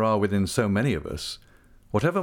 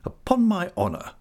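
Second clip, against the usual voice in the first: lightly voiced, grandly ornate gentleman; a hint of florid self-importance, pitched up; Micawber's pompous voice; 10 semitones higher than usual. A narrator's reading. Micawber to Copperfield, florid: lightly voiced pompous gentleman. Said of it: "said Mister Micawber".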